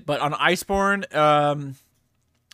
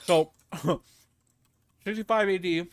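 mouth full; 3 semitones higher than usual. Real, not acted. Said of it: "Sorry, I'm eating right now".

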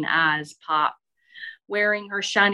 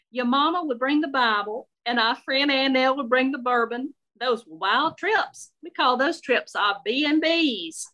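higher-pitched Southern matron, sympathetic then gossipy; chatty older Southern lady; 5 semitones higher than usual. Acted. Shirley consoles Elizabeth and recalls trips, chatty Southern lady. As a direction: reminisce freely as an unfiltered old Southern lady.

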